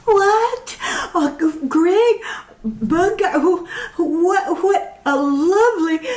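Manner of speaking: high, stammering gush; starstruck squealing. Acted. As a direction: babble like a starstruck fan. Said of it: "starstruck they blathered incoherently".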